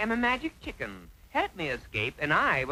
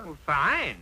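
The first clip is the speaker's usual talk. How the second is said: plain reading voice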